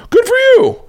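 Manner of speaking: very high, loud cheer; imitated squealed congratulations; Johnny Knoxville. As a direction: whoop it out, thrilled for a pal. Acts out Knoxville cheering him.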